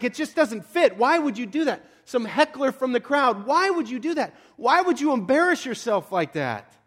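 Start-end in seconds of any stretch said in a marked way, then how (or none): 0.0-1.8 high-pitched, exasperated protest; put-on disbelief at a heckler. Concertgoer fed up with a heckler, exasperated. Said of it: "you're just like". 3.5-6.7 high, incredulous scolding; exasperated. Acted. Concertgoer scolding a heckler who spoiled the moment, exasperated. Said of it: "you're just like".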